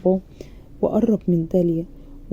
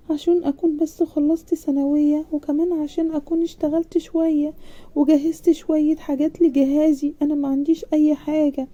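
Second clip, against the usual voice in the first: put-on shy young bride, a bit higher and hesitant; embarrassed girl; 7 semitones higher than usual. A narrator's reading. Young bride shyly pleading with her uncle. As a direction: bashfully ask to delay the wedding. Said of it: "داليا بإحراج كده".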